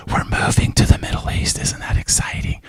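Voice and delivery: hushed, excited whisper to a sleeping wife; night whisper. Retold or imitated. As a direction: breathe it so as not to wake the house. Waking pregnant wife at night.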